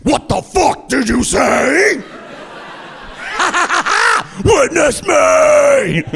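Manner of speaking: slow, roaring, very high shout; metal scream. Retold or imitated. Comic voicing grown-up Devin answering his teacher, roaring high and slow. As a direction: scream it like a metal frontman on stage. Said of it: "Devin's just like".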